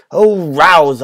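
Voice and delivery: an urgent loud plea; urging plea. Acted. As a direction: rouse him, plead loudly. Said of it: "I urged".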